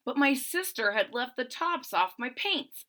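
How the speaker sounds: ordinary delivery, nothing marked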